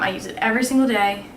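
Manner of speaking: normal talking voice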